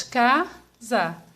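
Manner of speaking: "Casa" split as ca, za with a pause between; syllable by syllable. Shown as a demonstration. Teacher showing how to separate casa, syllable by syllable. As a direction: say "Casa" slowly, one syllable at a time. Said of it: "tenho duas sílabas".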